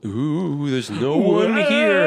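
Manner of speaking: high, loud, slow ghostly wail; hammed-up haunted-house moan; mock spooky. Crew finds the ship empty, mock ghostly wail.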